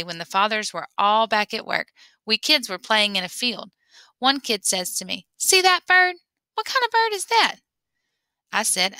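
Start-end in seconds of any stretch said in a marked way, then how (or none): 5.4-7.6 high, taunting imitation of a smug neighbour kid; mocking kid's voice. Boy teases narrator about his father, high mocking kid voice. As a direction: play the show-off kid testing him, pitched high.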